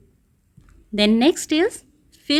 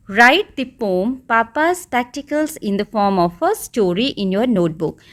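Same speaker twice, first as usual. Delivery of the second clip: slow, word by word; slow dictation; much slower than usual. Shown as a demonstration. Teacher slowly reading the homework task to pupils. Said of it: "reading the task aloud".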